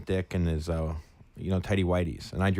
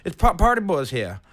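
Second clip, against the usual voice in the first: higher, louder hyped yell; shouting at the door; 10 semitones higher, 7 dB louder than usual. Retold or imitated. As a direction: call out while pounding on the door. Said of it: "pounding on the door going".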